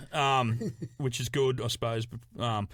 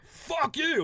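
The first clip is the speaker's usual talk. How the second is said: high, abrupt mock heckle; aggressive heckle. Crowd turning on him after singing, aggressively jeered. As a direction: fire it off as a blunt jeer. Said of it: "I just get aggressive points".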